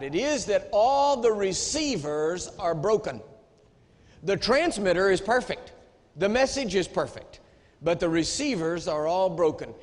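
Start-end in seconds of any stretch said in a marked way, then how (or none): none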